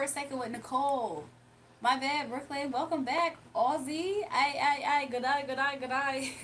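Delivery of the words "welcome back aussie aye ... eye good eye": playful mock British greeting; lightly put-on British accent. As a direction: toss it off in a jokey British accent. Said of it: "I've been talking like that, like, British a little bit".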